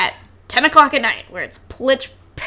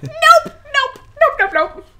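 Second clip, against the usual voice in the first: high, laughing run of nopes; fleeing the strangers, laughing; 12 semitones higher, 3 dB louder than usual. Retold or imitated. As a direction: giggle out the nopes.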